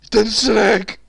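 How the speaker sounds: ordinary delivery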